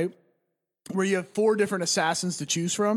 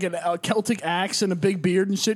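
normal talking voice

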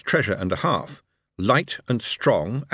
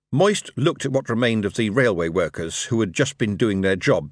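plain reading voice

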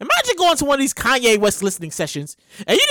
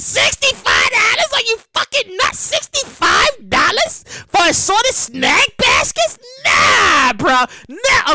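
price outrage; 8 semitones higher, slower than usual. Pretend. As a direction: wail at the ridiculous price. Shocked by menu prices.

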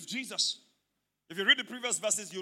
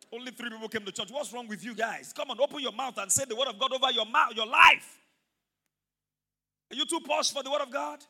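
normal talking voice

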